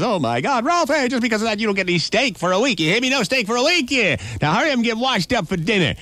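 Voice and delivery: high, fast scolding mother's voice; scolding mama. Acted. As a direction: tear into the boy like a fed-up mom. Mama scolds a third time.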